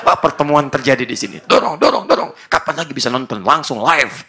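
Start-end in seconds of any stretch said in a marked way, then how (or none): 1.5-2.4 cheering. Pretend. Husband cheering football on TV.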